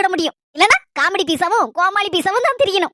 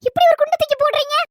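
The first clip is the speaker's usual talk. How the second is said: stunned protest; 7 semitones higher than usual. Acted. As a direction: hit him with your shock. Wife shocked by separation news.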